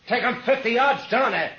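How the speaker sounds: shouted command across the yard; calling out. Acted. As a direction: yell the order down the road. Gunman yells to distant driver.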